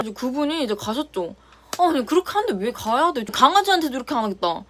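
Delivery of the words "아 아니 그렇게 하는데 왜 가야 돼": ordinary talk, nothing marked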